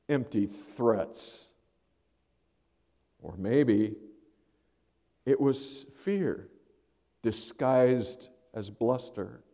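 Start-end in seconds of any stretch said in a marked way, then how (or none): none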